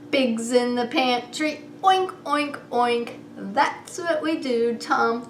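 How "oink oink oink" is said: ordinary delivery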